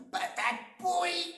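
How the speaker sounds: put-on boy sulking at his mother, high sulky voice; sulky kid insult. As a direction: play a grumpy little boy. Simon answers his father.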